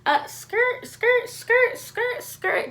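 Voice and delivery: high squeaky skirt skirt, dodging around; skidding sound effect. Retold or imitated. Dodging mom around the living room, high squeaky skidding noises. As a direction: make tire-skid noises while dodging. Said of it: "and I'm just like".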